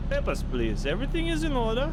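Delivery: mock German accent, high at the end. Shown as a demonstration. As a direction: put on a hammy German accent. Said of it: "with the German accent".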